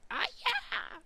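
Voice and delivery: very high squeaked "Ah, yeah!"; attempted signature falsetto cheer; old squeaky voice. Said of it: "I can't do that voice anymore".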